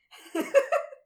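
squeaky silent giggle; can't hold the laugh in; high breathy laugh. Real, not acted. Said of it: "I'm laughing at my own question".

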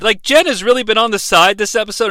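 ordinary talk

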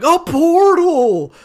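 hammed-up wonder at a portal; mock awestruck party. Friend retelling the game party finding a portal, hammed-up awe. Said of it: "They're like".

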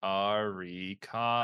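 slow, careful Arikado; slowly. Shown as a demonstration. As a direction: stretch out Arikado syllable by syllable. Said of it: "just the last name that slowly".

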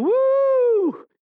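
high, loud mock party whoop. Preacher mimics a partygoer, loud high party whoop. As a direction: whoop it up like a bar crowd.

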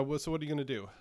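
usual speaking voice

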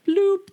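high, loud comic bloop; squeaky little bloop; imitated stab noise. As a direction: pop out a high cartoon stab sound.

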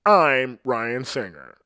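slow, high, puffed-up radio announcer; mocking the fake on-air voice he can't stand; douchebag radio DJ voice. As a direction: sell the station ID like a smug morning DJ. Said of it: "that douchebag voice".

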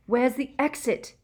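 loud, high frantic shout; desperate shout. A narrator's reading. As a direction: yell it in panic. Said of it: "he shouted desperately".

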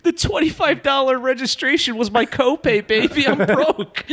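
high-pitched, cracking up; laughing joke protest. Pretend. Fighter admitting to coach he's broke, high and laughing. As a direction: squeal the excuse through laughter.

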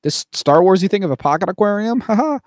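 pushy salesman. Retold or imitated. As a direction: pitch the gadget high like a huckster, with a cackle at the end. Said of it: "he's like".

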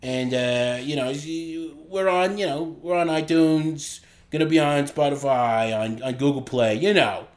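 broad put-on Boston accent; chatty Bostonian, dropping the r's. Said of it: "just gonna do the Boston accent".

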